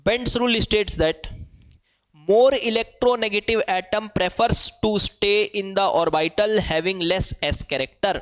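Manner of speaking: slow, spaced-out words for students to write; slow dictation. Shown as a demonstration. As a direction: dictate the rule word by word. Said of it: "लिखिएगा". (धीमी गति से notes लिखवाना)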